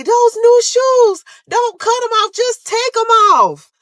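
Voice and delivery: very high, loud and slow wail; frantic shoe plea. Retold or imitated. Patient wailing at the nurse cutting her shoes. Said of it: "I'm stressing to the lady".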